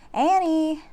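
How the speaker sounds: calling out loud for his sister; a called shout across the trees. A narrator's reading. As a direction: shout her name into the woods. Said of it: "he shouted again".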